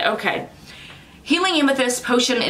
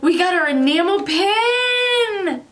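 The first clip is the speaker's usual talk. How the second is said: excited; 10 semitones higher, much slower than usual. Real, not acted. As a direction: squeal over the new pin. Unboxing excitement.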